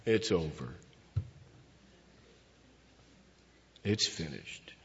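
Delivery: low, soft, beaten-down murmur; defeated. Shown as a demonstration. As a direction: say it like a man who has given up. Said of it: "it's not in a defeated way".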